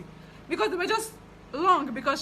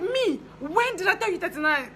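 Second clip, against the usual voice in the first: indignant denial; 8 semitones higher than usual. Retold or imitated. Client denying her own measurement to the tailor, mock indignant. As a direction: play the client's offended disbelief, pitched way up. Client denies saying thirty-nine.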